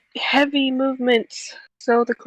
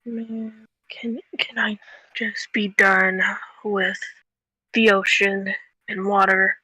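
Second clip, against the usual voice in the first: whispered complaint; 2 semitones lower than usual. Real, not acted. Weary of the ocean.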